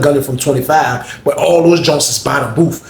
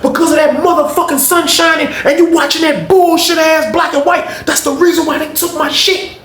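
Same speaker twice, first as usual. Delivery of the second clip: high, sustained yelling rant; furious retold rant; 14 semitones higher than usual. Honey bun man raging at cellmate over stolen buns, high furious rant. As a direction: blast him for losing the buns.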